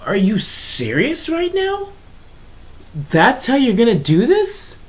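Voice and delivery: high, mock-shocked "are you serious" voice; incredulous disbelief. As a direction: act stunned and outraged at the line. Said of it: "And it's like".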